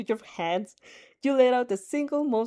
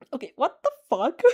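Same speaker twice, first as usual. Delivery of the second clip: ordinary delivery, nothing marked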